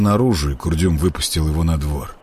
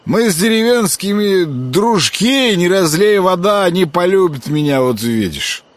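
put-on drunk slurring; 10 semitones higher than usual. A narrator's reading. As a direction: boast like a happy drunk. Drunk Grekhvost boasting.

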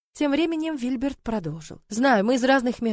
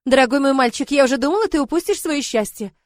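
raised, louder, delighted voice; joyful exclamation; 4 semitones higher, 6 dB louder than usual. Acted. Godmother relieved her boy chose happiness, joyful exclaiming. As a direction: gush with happy relief. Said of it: "радостно воскликнула Фелиция".

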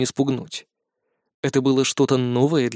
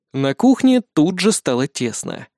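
usual speaking voice

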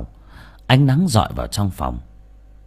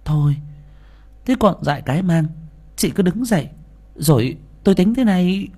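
high-pitched neighbour taking charge; neighbour woman; 10 semitones higher than usual. A narrator's reading. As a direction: talk a distressed friend round in a high voice. Neighbour voiced in narrated story. (giọng bà hàng xóm khuyên can)

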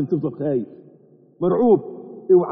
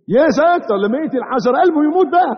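rising, louder preacher build; building emphasis; 8 semitones higher, 3 dB louder than usual. Acted. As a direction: build it up until the heart dies. Preacher building the hard heart.

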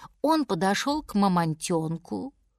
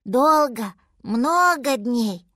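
drawn-out, small mammoth voice; little mammoth; 2 semitones higher, 4 dB louder, slower than usual. A narrator's reading. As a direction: answer slowly as a lost little animal. Little mammoth answers lion.

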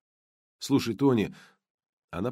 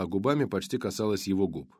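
ordinary delivery, nothing marked

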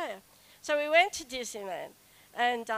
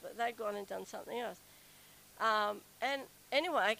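ordinary talk, nothing marked

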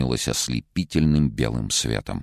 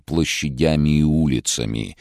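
plain reading voice

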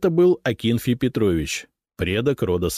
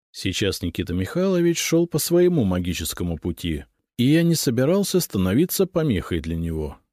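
plain reading voice

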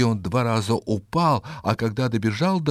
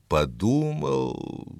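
drawn-out, pondering; slow thoughtful repeat; 2 semitones higher, much slower than usual. A narrator's reading. Narrator lingers on the old man's thinking, slow. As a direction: stretch the word as if thinking.

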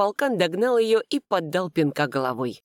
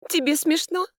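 high-pitched indignant question; 12 semitones higher than usual. A narrator's reading. Narrator confronts her laughing husband, indignant. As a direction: challenge him in a raised pitch. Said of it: "возмутилась я".

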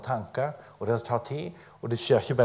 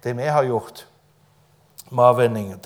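usual speaking voice

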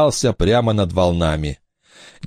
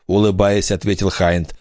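usual speaking voice

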